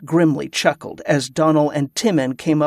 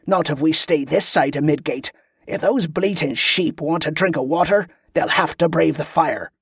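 ordinary delivery

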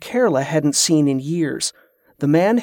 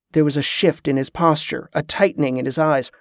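plain reading voice

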